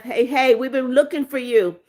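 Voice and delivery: normal talking voice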